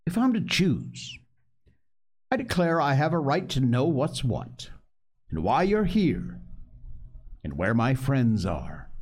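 put-on boy pushing back with rising courage; a bit bolder. Captive boy demands answers, growing a bit bolder. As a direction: speak up, a little braver. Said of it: "growing a bit bolder".

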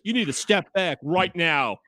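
slow, firm retold warning; stern, deliberate command. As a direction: order them back, spacing out the words. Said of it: "trying to be very stern".

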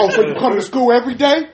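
hammed-up parental interrogation; mock angry dad. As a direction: play a dad catching out his kid. Acts out father's sarcastic grilling.

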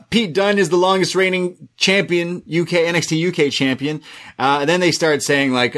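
normal talking voice

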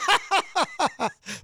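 high burst of giggling; involuntary giggle. Real, not acted. Host giggling after saying he's going to die, high giggle. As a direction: let a giggle escape after the line. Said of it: "but it happened".

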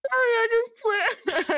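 ordinary talk, nothing marked

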